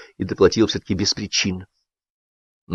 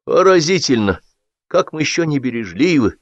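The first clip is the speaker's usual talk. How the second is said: pathetic; 6 semitones higher than usual. A narrator's reading. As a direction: declaim it with hammed-up pathos. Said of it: "патетично проговорил Кирилл Иванович".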